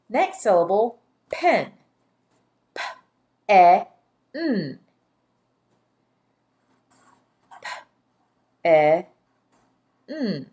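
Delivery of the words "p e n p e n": "P-e-n" as three isolated sounds; puffed P, short e, held n in "P-e-n"; sounded out. Shown as a demonstration. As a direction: tap out "P-e-n" sound by sound, with long gaps.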